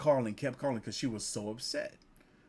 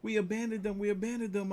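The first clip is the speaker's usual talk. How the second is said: much higher, fretting; mock guilty girls; 10 semitones higher than usual. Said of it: "the girls were talking".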